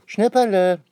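high, loud call for her brother. A narrator's reading. Anne-Rose calls for her little brother outside, loud call. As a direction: shout the name to find him. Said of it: "ruft Anne-Rose".

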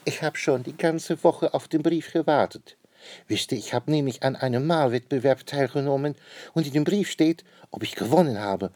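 a hint of a bubbly royal lady; the queen. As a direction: chatter excitedly about the contest. Queen's voice in story.

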